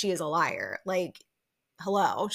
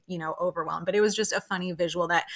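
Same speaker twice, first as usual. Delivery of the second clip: ordinary delivery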